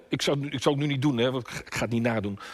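normal talking voice

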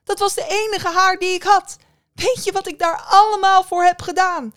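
high, loud, anguished protest; tearful outburst. A narrator's reading. Young woman protesting to the witch about the hair, tearful outburst. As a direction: cry out in upset indignation. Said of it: "terwijl de tranen in haar ogen sprongen".

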